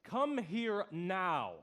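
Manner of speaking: each word slow and separate; drawn-out, deliberate command; stern slow command. Acted. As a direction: order them over very slowly. Conductor orders the intruder over.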